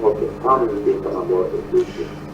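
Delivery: faint voice, far from the phone; phone held away. Real, not acted. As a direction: caller speaking away from the mouthpiece. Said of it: "Could you talk into the phone? You are not audible".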